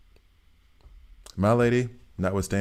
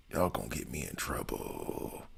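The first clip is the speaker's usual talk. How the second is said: low, much softer muttered repeat; mock grumble; 8 semitones lower, 14 dB quieter than usual. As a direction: drop it down and mumble it under the breath. Repeats the complaint to chat.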